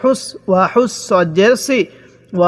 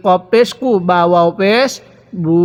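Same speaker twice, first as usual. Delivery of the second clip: ordinary talk, nothing marked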